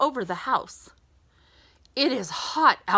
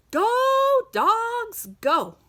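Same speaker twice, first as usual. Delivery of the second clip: high, drawn-out call; cheering; 13 semitones higher, 3 dB louder than usual. Acted. Reading to a child, cheering the racing dogs. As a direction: shout them on up high.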